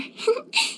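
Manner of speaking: a short light giggle rising at the end. Acted. Healer giggles after sharing her secret, light giggle. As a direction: giggle to yourself, pleased. Character laughs after advice.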